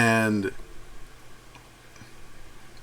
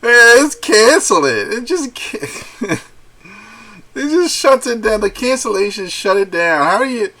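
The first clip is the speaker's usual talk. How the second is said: high-pitched, cracking up mid-sentence; laughing; 10 semitones higher, 5 dB louder than usual. Real, not acted. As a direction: struggle to talk through real laughter. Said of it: "That's funny".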